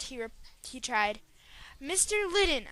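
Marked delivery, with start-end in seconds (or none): 1.8-2.7 interrupting. Acted. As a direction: cut in, pitched high. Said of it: "I interrupted".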